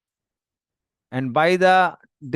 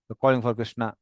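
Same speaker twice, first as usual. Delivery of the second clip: ordinary delivery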